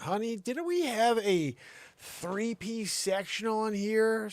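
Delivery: slow high baffled husband; slow high puzzled question. Retold or imitated. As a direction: drag it out, baffled. Husband asking wife about missing couch.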